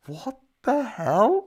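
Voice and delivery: high, drawn-out voice of a stunned mate; shocked disbelief. Retold or imitated. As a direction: stretch it out high, imitating an appalled friend. Retelling his shocked mate.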